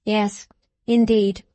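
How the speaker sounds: ordinary delivery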